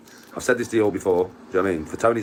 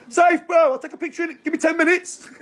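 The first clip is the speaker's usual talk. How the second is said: breezy high reply; 4 dB louder than usual. Pretend. Answering while mid-stride, very high.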